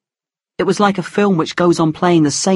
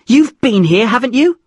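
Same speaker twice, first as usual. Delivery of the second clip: accusing; 7 semitones higher, 4 dB louder than usual. A narrator's reading. Mother accusing.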